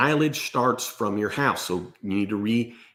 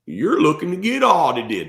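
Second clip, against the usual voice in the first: higher, louder mock old-timer warning; older tax pros' talk; 6 semitones higher, 6 dB louder than usual. Older guys come up after a seminar, mock warning. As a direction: ham up the grumpy old tax pro. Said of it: "And they usually talk like that".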